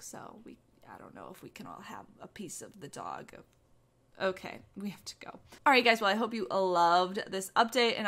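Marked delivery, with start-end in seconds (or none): 0.0-5.3 hushed secret whisper. Real, not acted. Secret aside to viewers.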